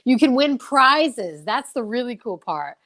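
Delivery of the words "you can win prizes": ordinary talk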